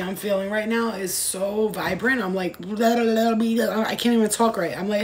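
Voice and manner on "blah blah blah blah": ordinary talk, nothing marked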